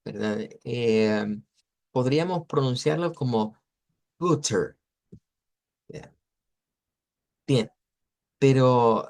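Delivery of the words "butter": butter with a true t in the middle; neutral English. Shown as a demonstration. Teacher showing neutral English pronunciation, butter with clear t. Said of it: "en inglés neutro".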